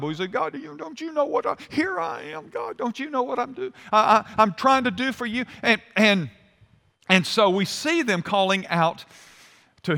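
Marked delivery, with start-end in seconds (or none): 0.3-5.5 high, soft mock whine to God; stammering, put-upon pleading in a raised pitch; complaining cry. Believer crying out to God in complaint, whining. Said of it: "we cry out to God".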